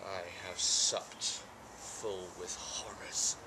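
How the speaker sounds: low, slow near-whisper; hushed horror. Acted. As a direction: draw it out softly, steeped in horror. Macbeth soliloquy, weary of horrors.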